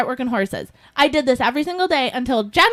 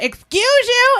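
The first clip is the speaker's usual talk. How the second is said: indignant retort; 10 semitones higher, 3 dB louder than usual. Retold or imitated. Confronting sighing shopper.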